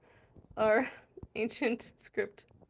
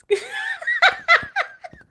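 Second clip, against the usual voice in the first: loud, high-pitched cackling laugh; burst of laughter; 14 dB louder than usual. Real, not acted. Cracking up at chat.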